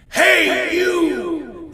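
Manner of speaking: high shouted echo fading away. Acted. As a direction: bounce it back like an echo. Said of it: "echoed the lion in the well".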